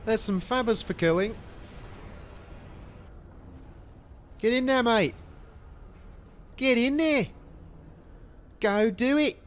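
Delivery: shouting like an Aussie mate, badly faked Australian accent. Caster urging Admiral on in a bad Australian accent. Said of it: "That was not a good accent".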